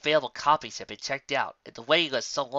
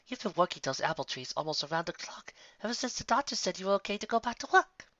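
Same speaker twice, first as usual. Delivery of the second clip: lightly voiced refined lady, higher; Rarity voice; 8 semitones higher, 3 dB quieter than usual. Rarity urging Applejack to rest, lightly voiced. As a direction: coax her gently, a touch higher.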